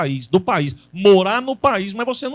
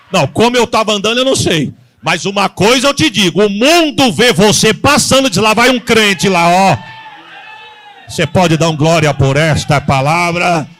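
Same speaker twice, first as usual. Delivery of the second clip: fiery pulpit proclamation; full-force preaching; 3 semitones higher, 9 dB louder than usual. Acted. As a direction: preach it out to the whole church.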